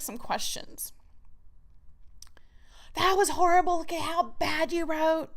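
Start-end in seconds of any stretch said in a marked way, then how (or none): 2.9-5.3 higher, louder sneering critic voice; harsh inner critic. Acted. Writer acting out the critic's loud put-down, sneering. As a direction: spit it out like a cruel inner judge.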